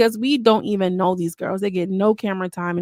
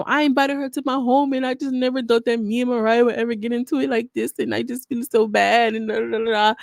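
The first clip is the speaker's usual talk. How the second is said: a put-on Dia accent, higher, mock fake-sad; 4 semitones higher than usual. Vlogger mocking Anna's fake sadness, in Dia's put-on accent. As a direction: quote her in Dia's accent with mock sorrow. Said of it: "I'm doing my Dia accent today".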